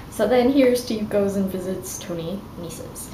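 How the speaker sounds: ordinary talk, nothing marked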